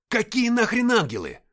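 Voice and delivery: high, loud yelled question; exasperated shout. A narrator's reading. Captain cut short on the radio, shouting. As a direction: yell into the radio. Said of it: "крикнул я".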